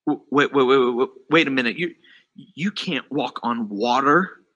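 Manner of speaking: low, stammered double-take; dawning realization. Retold or imitated. Peter realizing he is standing on water, stammered disbelief.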